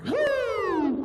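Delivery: loud, high imitated police siren; siren whoop. Driver hearing police behind him, loud high siren whoop. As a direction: whoop like a siren, rising and falling. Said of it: "I hear".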